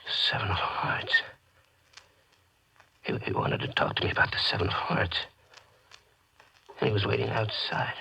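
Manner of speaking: horrified whisper to himself. Acted. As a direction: murmur it in frozen shock. Shocked man repeats the message.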